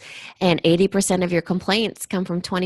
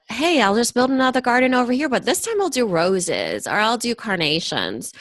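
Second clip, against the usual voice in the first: higher-pitched, carefree imitation of a scattered planner; breezy mock quote; 5 semitones higher than usual. Host mimics business owners starting yet another garden, breezy and higher. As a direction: act out someone airily changing plans, pitched up.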